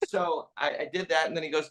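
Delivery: normal talking voice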